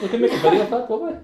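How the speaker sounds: high, loud and laughing; teasing laugh. Real, not acted. Husband teases his wife about filming her bath, laughing. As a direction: tease her while cracking up.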